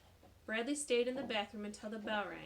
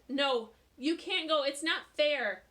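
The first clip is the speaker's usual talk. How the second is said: loud, slow, pleading; boy begging his teacher to stay; upset protest; 6 semitones higher, 12 dB louder, slower than usual. Acted. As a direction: stretch it out in protest. Bradley protests loudly.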